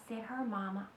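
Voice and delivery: ordinary delivery, nothing marked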